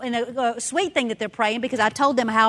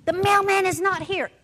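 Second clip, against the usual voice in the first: put-on higher, huffy little girl voice; indignant child; 7 semitones higher than usual. Daughter complaining to mom the mailman is late, huffy. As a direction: huff it like a cross kid.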